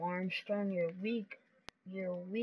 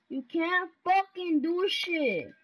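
shouted taunt; 6 semitones higher, 3 dB louder than usual. Real, not acted. Trash talk, then calmer repeat.

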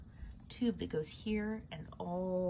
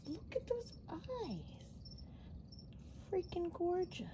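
high pet voice; 10 semitones higher, 6 dB quieter than usual. Real, not acted. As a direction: coo at her like a pet. Admiring spider, pet talk.

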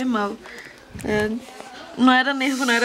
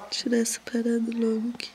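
whispering while hiding; 6 dB quieter than usual. Real, not acted. Muttering while working on the foot.

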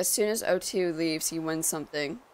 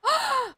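sharp high gasp; 4 dB louder than usual. Real, not acted. Gasp at gold.

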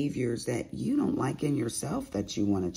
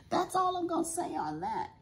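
squeaky sign-off. Real, not acted.

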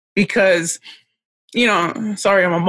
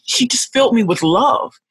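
normal talking voice

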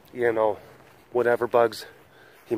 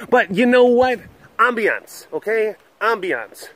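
higher, louder, savoring Ambiance; mock posh ambiance; 9 semitones higher, 3 dB louder than usual. Real, not acted. Host hamming up the fireplace for viewers, mock-grand. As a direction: sell the word Ambiance with flair.

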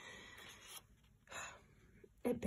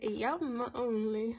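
ordinary talk

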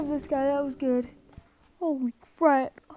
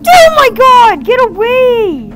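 screaming high and very loud in real panic; terrified scream; 9 semitones higher, 18 dB louder, slower than usual. Real, not acted. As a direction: shriek it out, properly terrified. Jump scare in horror game.

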